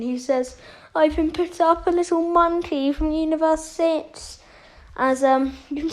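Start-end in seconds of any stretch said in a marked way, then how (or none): 0.9-4.4 higher-pitched, mildly mocking villain; lightly voiced laughing Frieza. Frieza walks into the arena and scoffs at his opponent, lightly mocking. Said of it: "he starts laughing".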